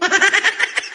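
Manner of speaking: high burst of mocking laughter. Real, not acted. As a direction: laugh right at them. Mocking the deported Pakistanis.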